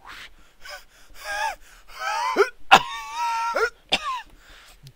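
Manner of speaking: hammed-up breathy blow into high coughing fit; imitated exhale and cough. Vaper hacking after a big hit, exaggerated coughing. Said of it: "he does his little exhale technique".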